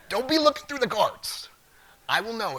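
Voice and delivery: high, loud teacher scold; mock kindergarten teacher telling off; mock stern warning. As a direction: playfully scold the class like kids.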